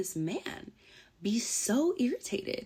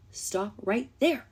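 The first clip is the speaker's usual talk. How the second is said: slow emphatic stop; much slower than usual. Shown as a demonstration. To the ex through the camera, slow firm stop. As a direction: drag each word out slowly.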